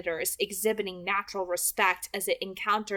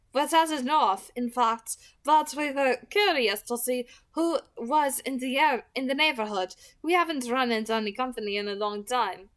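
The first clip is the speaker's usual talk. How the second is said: a hint of a silky nomad vampire, a bit louder; easy, probing friendliness; casual stranger sizing up the locals; lightly voiced Lauren, curious; 5 semitones higher, 4 dB louder than usual. A narrator's reading. Lauren explains their travels.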